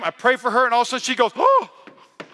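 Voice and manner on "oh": high, loud "oh" of shock; retold gasp, pitched way up; startled cry. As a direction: imitate a sudden startled yelp. Imitates girl's sudden reaction in prayer.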